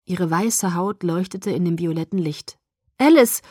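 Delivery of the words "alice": called out, high and loud; relieved call. A narrator's reading. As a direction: call out in relief across the room. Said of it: "rief ich erleichtert".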